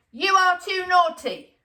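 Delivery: put-on high, loud child telling off; boy scolding. A narrator's reading. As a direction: lay down the law to your monkeys. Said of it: "he told the monkeys".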